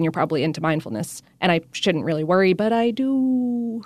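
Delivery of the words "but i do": put-on old lady ghost voice. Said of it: "these old lady ghost voices".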